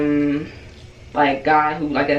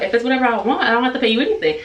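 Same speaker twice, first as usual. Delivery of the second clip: indignant; 7 semitones higher, much faster than usual. Retold or imitated. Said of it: "And I'm like".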